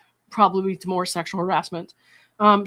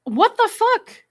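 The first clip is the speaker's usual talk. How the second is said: very high, slow, stunned what the fuck; drawn-out shocked squeal; aghast and incredulous; disbelief; 13 semitones higher, 3 dB louder, much slower than usual. Real, not acted. Reacting to creepy message.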